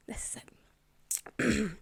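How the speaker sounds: soft unvoiced whisper. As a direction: breathe it, barely voiced. Mum's words retold as whisper.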